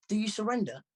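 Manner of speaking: put-on cheeky girl goading her rival; impish girl. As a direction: grin while you dare him. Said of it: "grinning impishly".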